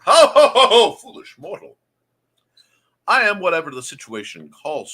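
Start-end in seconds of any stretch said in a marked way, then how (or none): 0.1-1.8 booming villain laugh and taunt; hammed-up evil overlord, higher and louder; dark lord. Mock villain reply.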